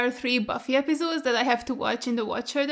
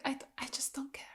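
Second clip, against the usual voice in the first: unvoiced, much softer whisper; 14 dB quieter than usual. Real, not acted. Dismissing Riley to viewers, whispered. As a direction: drop to a tired whisper.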